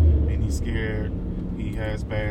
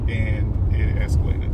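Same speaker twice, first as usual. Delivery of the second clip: usual speaking voice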